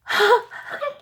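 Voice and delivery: sudden high delighted gasp; high gasp of surprise. Real, not acted. Feeling something soft in the gift.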